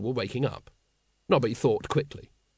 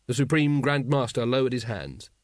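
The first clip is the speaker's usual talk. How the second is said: usual speaking voice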